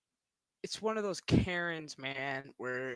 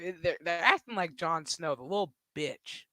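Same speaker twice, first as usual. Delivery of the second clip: normal talking voice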